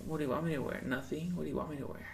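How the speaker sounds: put-on soft, teasing falsetto girl voice. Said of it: "try doing a girl voice".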